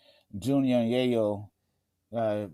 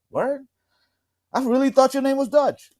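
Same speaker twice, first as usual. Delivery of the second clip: high, surprised, a bit louder; mock the friend's disbelief, pitched up; 14 semitones higher, 5 dB louder than usual. Retelling friends shocked to learn his real name, high surprised voice. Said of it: "they be like".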